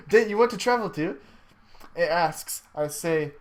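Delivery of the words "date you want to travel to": higher, quick, lightly voiced menu prompt; questioning machine prompt. Time machine asks the traveler, lightly voiced quick question. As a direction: rattle off the question with rising pitch.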